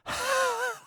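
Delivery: sudden high squealing laugh; a high giggle breaking through the bit; high burst of laughter. Real, not acted. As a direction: let out a real high-pitched laugh. Laughs at his own Bitcoin bit.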